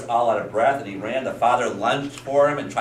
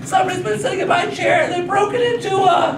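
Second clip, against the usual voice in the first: very high, loud mock wail; crying little girl; 5 dB louder than usual. Little girl finds her chair broken, hammed-up crying. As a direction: bawl it out like a small child. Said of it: "let me hear you cry".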